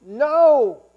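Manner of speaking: sharp, loud flat denial; Peter's final shouted denial. Acted. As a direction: bark out a loud no.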